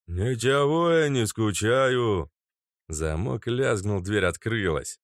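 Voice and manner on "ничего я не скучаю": slow offended guard. Acted. Guard defends himself.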